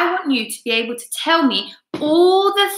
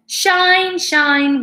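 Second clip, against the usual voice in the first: bright loud call, first one high; shining sun; 8 semitones higher, 6 dB louder than usual. A narrator's reading. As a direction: ring it out as the sun. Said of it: "went the sun".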